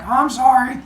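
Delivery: put-on high, loud little-kid sorry; lightly voiced scolded child; acted child's apology. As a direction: pitch it up like a kid caught misbehaving. Child apologises to angry Apollo.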